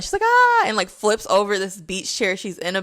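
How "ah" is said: short high yell, mock scream; imitated scream. Girl screaming at her mom by the beach chair, high mock yell. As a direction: shriek it out like a teen losing it.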